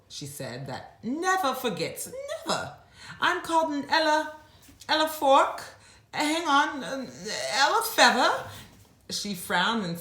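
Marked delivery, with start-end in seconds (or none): 0.7-8.3 forgetful elephant. Acted.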